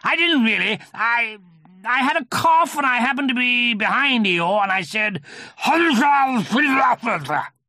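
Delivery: high, loud, flustered protest; Tigger. A narrator's reading. Tigger excusing his cough, loud and flustered. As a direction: protest excitedly, building to a wild spluttered shout.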